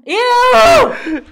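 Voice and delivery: loud, high-pitched "ew"; disgusted squeal. Acted. Cringing at hated slang with cohost, disgusted squeal. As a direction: squeal it in exaggerated revulsion.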